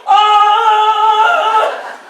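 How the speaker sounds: high, loud sung-out ah to the crowd; joyful yell back. Retold or imitated. Answering the cheering crowd while running, high loud ah. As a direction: belt it back to the cheering people. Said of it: "So I turn around and go".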